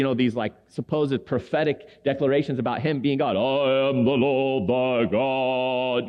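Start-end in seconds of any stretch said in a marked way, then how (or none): 3.4-6.1 slow, intoned proclamation; deep booming voice. Retold or imitated. Man in Santa Monica declaring himself God, slow booming intonation. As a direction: boom it like a self-declared god. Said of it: "deep booming voice, eloquent Old English".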